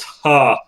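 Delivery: loud, high, single mock "ha"; punched-out fake laugh; imitated single laugh. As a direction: imitate a game character's one-shot laugh. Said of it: "the laughing one where the guy goes".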